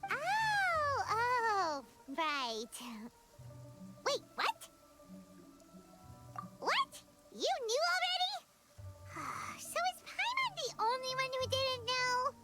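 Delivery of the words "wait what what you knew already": high-pitched, quick astonished questions; shocked disbelief. Acted. Companion learns others knew Shenhe is human, shocked disbelief. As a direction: blurt it out in astonishment.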